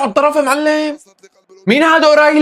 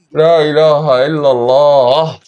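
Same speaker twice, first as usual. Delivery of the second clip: drawn-out, lower, hoarse-sounding sigh of a phrase; slow and low like the voice is already worn out; a fan who has shouted himself hoarse, groaning; voice gone; 8 semitones lower, much slower than usual. Real, not acted. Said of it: "صوتي راح من قبل أول دقيقة".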